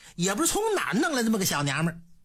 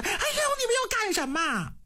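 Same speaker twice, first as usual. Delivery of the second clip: very high, fast startled cry; frightened scream; 12 semitones higher, faster than usual. A narrator's reading. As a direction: shriek in fright as the covers are pulled off. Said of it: "当时嗷嗷就一嗓子". (受惊尖叫)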